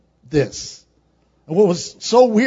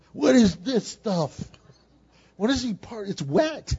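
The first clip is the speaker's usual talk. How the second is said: retold confused crying; 5 dB quieter than usual. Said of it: "and I'm crying".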